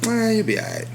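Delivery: high, breezy shrug-off; mock carefree high; careless dismissal. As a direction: brush it off like nothing matters.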